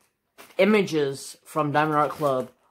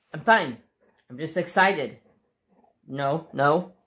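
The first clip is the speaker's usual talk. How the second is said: ordinary talk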